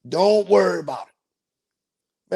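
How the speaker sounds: lazy, slow, easy reassurance; slow drawn-out reassurance. Shown as a demonstration. Slow teasing reassurance to a worried passenger. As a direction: stretch every word out slowly. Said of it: "repeated slowly, drawn out".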